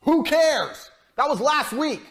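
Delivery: high, loud dismissive shout; angry outburst. Real, not acted. Scolding followers who brag about last week, loud and angry. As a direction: bark it back, fed up.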